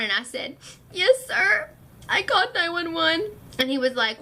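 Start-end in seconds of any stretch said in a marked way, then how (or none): 0.9-3.2 small, high, nervous voice; child admitting to a police officer she called; scared admission. Retold or imitated. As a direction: confess to the officer, frightened. Scared kid admits to cop.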